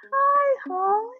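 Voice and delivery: put-on squeaky high baby talk to a newborn sister. Big brother greets newborn in high baby voice. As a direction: coo it way up high like a little boy. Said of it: "in this really high voice".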